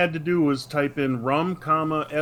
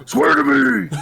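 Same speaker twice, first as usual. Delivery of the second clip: much higher, loud mock roaring yell; famous Batman shout; 13 semitones higher, 8 dB louder, slower than usual. Batman demands a promise, hammed-up roaring yell. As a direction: bellow it like an overwrought caped hero.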